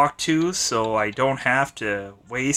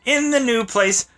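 high teasing voice; 9 semitones higher than usual. Acted. Gleeful taunt about breaking things.

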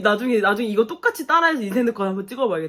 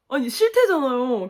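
ordinary talk, nothing marked

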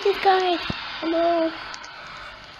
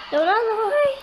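higher searching call; raised, questioning call for a missing companion; calling out questioningly; 6 semitones higher, 4 dB louder than usual. Real, not acted. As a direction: call out for someone you have lost. Calling for a missing game character.

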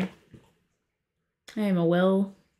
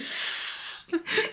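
high squeaky breath in; accidental squeak on an inhale; squeaky gasp; 9 semitones higher, 7 dB quieter than usual. Real, not acted. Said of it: "She squeaked".

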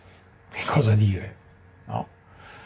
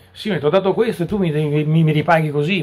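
louder, higher, hurried grievance; complaining believer; 6 semitones higher, 6 dB louder than usual. Retold or imitated. Believer reckoning up favours with the Lord, indignant complaint. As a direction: protest like a wronged devotee settling accounts. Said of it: "fedeli che ragionano in questo modo".

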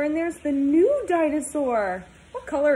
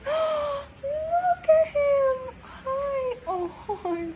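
excited puppy greeting; 13 semitones higher, much slower than usual. Real, not acted. Teacher cooing at a puppy for her class, high and gushing. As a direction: coo over the new puppy.

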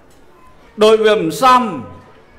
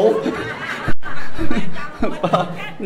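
a real, higher burst of laughter; hearty laugh; 5 semitones higher than usual. Real, not acted. Monk laughing at his own joke to the congregation, hearty laugh. Joking about his mother.

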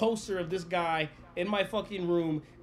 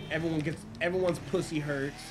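ordinary delivery

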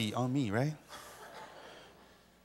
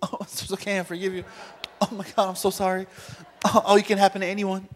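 higher-pitched, gushing pretend reassurance; mock forgiving victim; 3 semitones higher, 3 dB quieter, faster than usual. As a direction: imitate someone far too quick to forgive. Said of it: "just gonna sit there and go".

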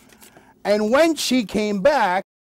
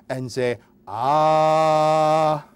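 very slowly stretched "Open up and say ah"; slow, overdone say ah; 5 semitones lower, much slower than usual. Shown as a demonstration. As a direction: spell out "say ah" slowly as if to a toddler. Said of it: "It's very simple, please".

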